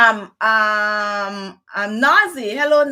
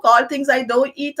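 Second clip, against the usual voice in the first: ordinary delivery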